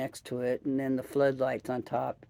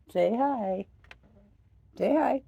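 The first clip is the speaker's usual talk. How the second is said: much higher, louder coaxing voice; pet talk; 10 semitones higher, 5 dB louder than usual. Real, not acted. Owner coaxes her cat to say hi, high pet talk. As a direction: sweet-talk the cat into answering.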